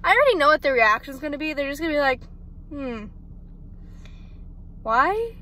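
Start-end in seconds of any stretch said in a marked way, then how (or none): none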